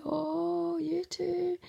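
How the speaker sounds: high, tender mock cooing; besotted dad's face voiced. New dad over his baby, lightly voiced high coo. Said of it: "his face was just like".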